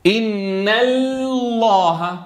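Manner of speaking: drawn-out "إن الله", lam starting light and turning heavy; light-then-heavy lam. Shown as a demonstration. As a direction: stretch the lam of "الله" in "إن الله", thin first, then thick. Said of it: "بيبدأ بلام مرققة".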